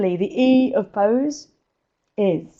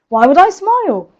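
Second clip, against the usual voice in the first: high, louder mimicked objection; mock protesting question; 8 semitones higher, 6 dB louder than usual. Coach imitating clients who fight her on smiling, mock incredulous.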